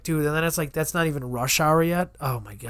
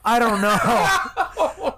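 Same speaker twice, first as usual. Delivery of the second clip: laughing; 7 semitones higher, 4 dB louder, much slower than usual. Real, not acted. Joking aside, laughing.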